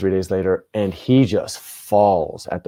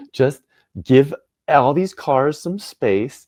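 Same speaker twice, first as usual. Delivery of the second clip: slow, high and loud, mock exasperation; exasperated plea; 7 semitones higher, 4 dB louder, much slower than usual. Man lecturing young truck drivers, drawn-out exasperated plea. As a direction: plead slowly, stretching each word higher.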